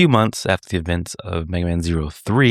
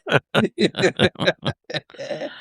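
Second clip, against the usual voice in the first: high laugh; 10 semitones higher than usual. Real, not acted. Laughs after jingle.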